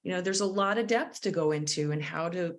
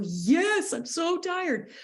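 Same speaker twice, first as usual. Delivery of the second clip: weary higher reply; 11 semitones higher, slower than usual. Retold or imitated. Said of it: "Or they might go".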